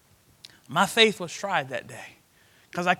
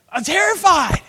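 terrified; 9 semitones higher, 7 dB louder than usual. Retold or imitated. Retold terror.

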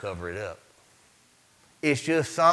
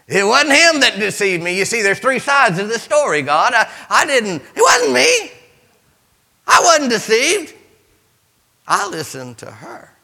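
loud, high preaching cadence; fiery pulpit preaching; preaching at full force; 4 semitones higher, 8 dB louder than usual. Acted. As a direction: drive the story home to the congregation. Preaching the garden story.

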